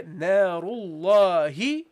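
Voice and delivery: Narullahi slow, long drawn la; extended recitation. Shown as a demonstration. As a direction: stretch the alif in Narullahi. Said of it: "So you go here".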